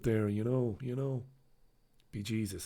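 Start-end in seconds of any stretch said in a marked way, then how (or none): 2.1-2.7 quiet, lightly Irish "Be Jesus"; mock Irish exclamation. To the listeners, a quiet mock Irish exclamation. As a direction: toss off "Be Jesus" in a soft Irish lilt. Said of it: "I said".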